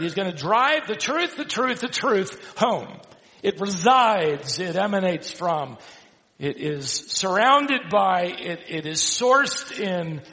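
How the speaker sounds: impassioned sermon delivery; emphatic preaching. Real, not acted. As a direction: preach it out, stretching the key words. Preaches on the verse.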